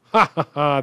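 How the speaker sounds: real laugh. Real, not acted. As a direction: laugh at your own careless click. Laughs at own mistake.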